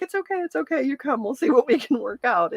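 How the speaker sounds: high-pitched, hurried soothing; placating. Retold or imitated. Daughter retelling how she calmed her dad, placating. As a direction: talk an upset dad down in a high voice.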